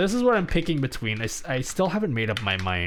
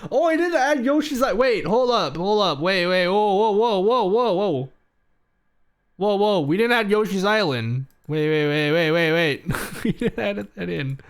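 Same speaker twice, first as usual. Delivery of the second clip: louder and higher, tumbling wait and whoa; real alarm as the mistake sinks in; startled realization; 5 semitones higher, 4 dB louder than usual. Real, not acted. Streamer spots the missing poll option, loud and startled.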